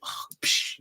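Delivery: imitated creepy breather; heavy breathing. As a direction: breathe heavily like someone lurking behind. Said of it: "And then the dude be breathing".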